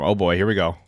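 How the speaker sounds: usual speaking voice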